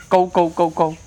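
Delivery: normal talking voice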